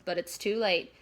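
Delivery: ordinary delivery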